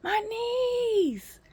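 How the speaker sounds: high-pitched cry of pain, ending loud; retold scream. Patient retells crying out over her knees, screaming. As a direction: wail it out, hammed-up agony. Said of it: "I was screaming".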